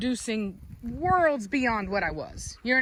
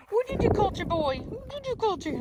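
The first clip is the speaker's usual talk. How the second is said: much higher teasing coo; high puppy talk; 10 semitones higher than usual. Real, not acted. Breeder high-voiced asking puppy what it did.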